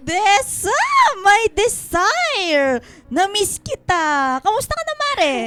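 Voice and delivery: loud, high, drawn-out delight; gushing greeting. Acted. Friend gushing loudly on arriving at the house. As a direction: swoon over seeing your friend.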